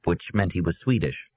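ordinary delivery

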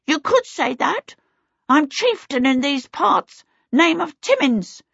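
high-pitched little kobold chieftain; self-important kobold. A narrator's reading. Kobold chieftain introducing himself to Charmaine, self-important. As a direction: introduce yourself as a small creature in charge.

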